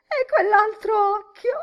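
high, loud howl of mirth; yelling. A narrator's reading. Miss Deuce echoing her friend's joke, yelling. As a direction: howl it back at your friend.

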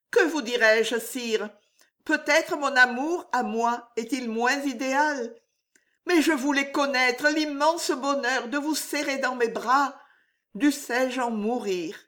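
a hint of a young woman in love; Juliette's voice.

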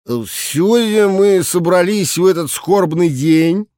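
put-on slow, slurred drunk speech; slurring like a drunk director; drunken slur. A narrator's reading. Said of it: "начал он заплетающимся голосом". (пьяный заплетающийся голос)

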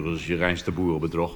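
usual speaking voice

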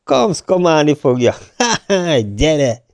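amused outburst broken by laughs; delighted guffawing exclamation; bursting out laughing. A narrator's reading. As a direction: laugh heartily between the phrases.

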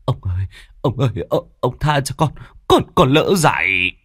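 hurried, stammering plea; young man pleading with the angry master; begging. Acted. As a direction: beg for mercy, tripping over words. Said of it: "vội vàng van xin".

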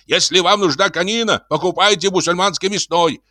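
high-pitched frantic lecture; worked-up building manager; hysterical scolding. A narrator's reading. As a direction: berate the tenant, voice stuck up high. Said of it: "истерически кричал управдом".

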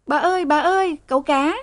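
put-on high-pitched frightened call of a young maid; shrill maid's cry. A narrator's reading. Young maid calls her mistress, high and piercing. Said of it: "Sen the thé".

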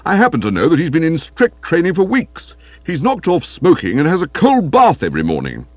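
stern, worked-up reproach; hectoring Stilton, indignant. Acted. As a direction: lecture a slacker like an officious trainer. Stilton rebukes Bertie.

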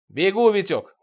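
quick holler; calling out. A narrator's reading. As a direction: call back that you're coming. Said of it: "крикнул я".

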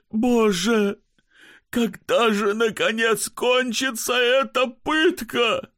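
high, slow anguished wail; tormented sick man. Acted. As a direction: cry out in agony and despair.

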